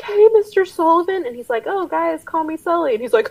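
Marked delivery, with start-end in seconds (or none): 0.0-1.2 put-on high, loud cracking teen voice; mock teenager greeting; scratchy puberty voice. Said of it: "scratchy puberty voice". 1.7-2.9 higher, casual mock Sully; a hint of the easygoing boss; lightly voiced friendly Sully.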